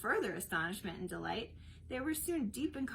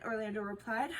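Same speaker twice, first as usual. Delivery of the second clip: usual speaking voice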